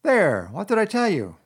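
high, loud exclamation of triumph; triumphant. A narrator's reading. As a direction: crow that you were right. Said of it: "exclaimed the rat in great triumph".

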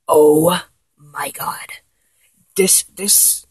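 slow voiceless whisper of disbelief; awed whisper. Acted. Staring at the new body, a slow awed whisper. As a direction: breathe it out in amazement.

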